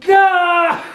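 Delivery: high-pitched "Ah!" of dismay; sudden high yelp, imitated; mock horrified cry. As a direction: cry out in hammed-up horror. Imitating friend's horror in kitchen.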